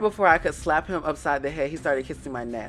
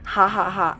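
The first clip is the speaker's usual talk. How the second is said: read-out laugh; 5 semitones higher, 6 dB louder than usual. Acted. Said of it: "that's how I read that".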